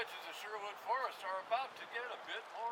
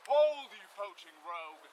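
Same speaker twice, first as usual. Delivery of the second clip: stern command; 9 dB louder, slower than usual. Acted. Sheriff's man seizes poacher.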